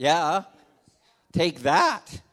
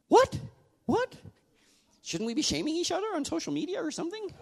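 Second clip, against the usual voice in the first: high-pitched sarcastic What?, much higher; mock indignation; faster than usual. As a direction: pretend to be scandalised. Mock outrage at agreeing to disagree.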